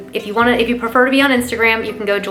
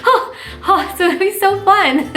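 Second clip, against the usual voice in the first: much higher, giddy half-laughing squeal; squealing excitement; 10 semitones higher than usual. Real, not acted. Thrilled about French Week, a giddy high squeal. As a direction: squeal with delight, voice cracking. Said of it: "I'm like".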